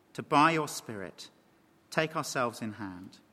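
low, soft, measured prayer voice; preacher leads the congregation in closing prayer. Real, not acted.

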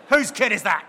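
loud, indignant demand; annoyed adult loudly asks whose child it is; indignant shout. Acted. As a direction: call out who owns the kid. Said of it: "acted indignant question".

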